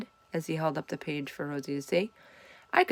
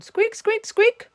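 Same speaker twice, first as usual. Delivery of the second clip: high, loud squeaky monkey chatter; monkey squeaks; 7 dB louder, slower than usual. Acted. Hamster pretending to be a monkey for Joey, high squeaks. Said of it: "I tried to sound like a monkey".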